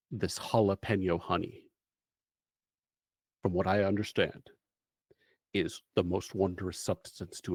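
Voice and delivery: lightly voiced, solemn mission briefing; measured storyteller laying out the mission; game master's briefing. GM scene briefing narration.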